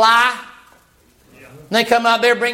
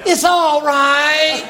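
put-on high, cooing mother soothing a baby; soothing mama voice; 10 semitones higher than usual. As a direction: coo him like a fussy infant. Jezebel soothes pouting Ahab.

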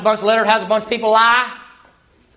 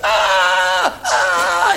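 wounded cry. Retold or imitated. Ahab struck by an arrow.

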